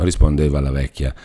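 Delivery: plain reading voice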